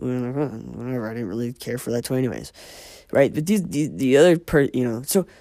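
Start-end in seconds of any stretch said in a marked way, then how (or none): 0.0-2.5 put-on soft, offhand child who doesn't care; mumbled mild indifference, quieter than usual; dismissive shrug. Indifferent child letting go of a taken toy, dismissive.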